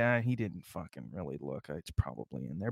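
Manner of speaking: resigned low grumble about the gym clerk; defeated mutter. Retold or imitated. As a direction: grumble it to yourself, beaten. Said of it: "you're gonna say".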